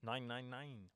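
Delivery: a hint of a German accent on nine nine nine; a lightly German-sounding chant; accidental German accent. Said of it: "sounded like I was doing a German accent".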